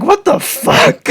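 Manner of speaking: high, loud, slow stunned outcry; high loud shocked what the fuck; shocked disbelief. Retold or imitated. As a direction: react like you just heard something outrageous. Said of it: "Feyre is like".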